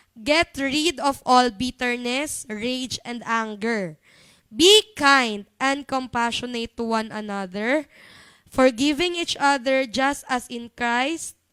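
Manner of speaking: slow, emphatic scripture reading; verse reading. Acted. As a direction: read the verse out firmly, phrase by phrase. Reads the key verse aloud. (mabagal at madiing pagbasa ng talata)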